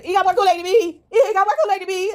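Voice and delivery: put-on high whining child complaining about Kool-Aid; whiny kid. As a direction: whine like a jealous little kid.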